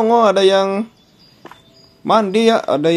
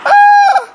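sharp high shriek of fright; startled scream; 7 dB louder than usual. Real, not acted. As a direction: shriek like a jump scare hit. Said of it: "kaget, coy".

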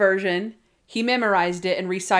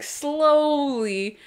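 SLOWLY stretched out, raised in pitch; drawn-out slowly; 6 semitones higher than usual. Retold or imitated. Describing a table turned over gently, stretched-out word. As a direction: draw out the word slowly, higher.

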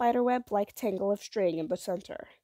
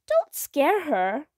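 scolding; 7 semitones higher, 6 dB louder, much slower than usual. A narrator's reading. Mother scolds father.

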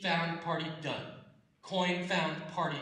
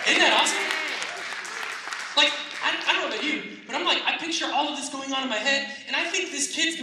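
much higher and louder, bursting with delight; excited gushing; 11 semitones higher, 10 dB louder, faster than usual. Real, not acted. Preacher telling the crowd the story thrills him, excited. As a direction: pitch it up high and loud like you can't contain the joy. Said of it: "preacher gushing excitedly".

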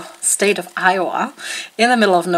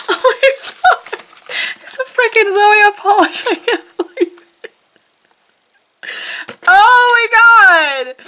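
thrilled; 11 semitones higher than usual. Real, not acted. Finds a Zoya polish.

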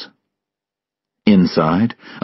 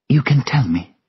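high, hushed character voice; lightly whispery, high-pitched plea; gentle Sylvia voice; 9 semitones higher than usual. A narrator's reading. Narrator voices Sylvia awaiting bad news.

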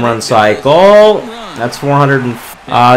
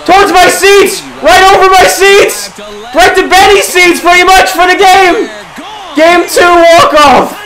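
ecstatic shouting; 11 semitones higher than usual. Real, not acted. Gamer yelling as the walk-off flies over his seats. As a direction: go wild for the walk-off.

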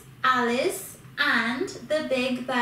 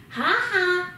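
loud, higher mocking ha ha; a cheeky gloating laugh; the bogey laughs at the woodcutter; 5 semitones higher, 5 dB louder than usual. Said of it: "laughed the big bad bogey".

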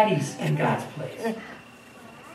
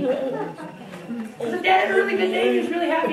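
laughing; 9 semitones higher than usual. Real, not acted. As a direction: giggle at dad's good mood. Mocks dad's good mood.

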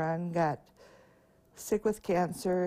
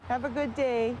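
cheery send-off; 7 semitones higher, 3 dB louder than usual. Real, not acted. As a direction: wish them well as they cross. Sending kids off at crossing.

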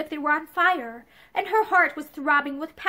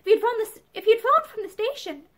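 high, hesitant protest; faltering; 7 semitones higher than usual. A narrator's reading. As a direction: falter as you make excuses. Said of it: "Pansy faltered".

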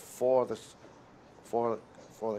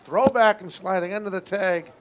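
excited play call; 6 semitones higher, 7 dB louder than usual. Real, not acted. Calling a close play at base.

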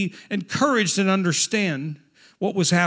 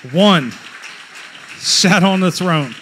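loud, slow declaration of scripture; emphatic pulpit cry, ONE set apart; forceful proclamation; 3 semitones lower, 4 dB louder, slower than usual. Real, not acted. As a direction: proclaim it loudly, drawing out each word. Repeats the verse for emphasis.

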